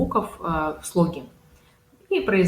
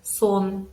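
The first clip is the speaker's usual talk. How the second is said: СОН with a full, drawn O; stressed O syllable; 3 semitones higher than usual. Shown as a demonstration. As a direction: model СОН as a drill word. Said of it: "мы ударение ставим на первый слог".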